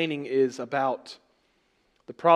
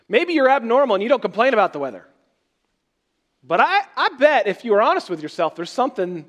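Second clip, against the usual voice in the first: teasing challenge; 9 semitones higher, 9 dB louder, faster than usual. Acted. Preacher teasing the congregation.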